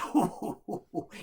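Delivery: falling villainous chuckle; scheming laugh. Acted. Innkeeper shows off the room, scheming chuckle.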